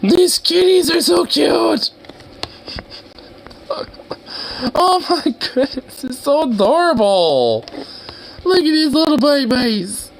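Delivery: a real high-pitched squeal of cuteness; squealy cooing. Real, not acted. Cooing over kitten pictures.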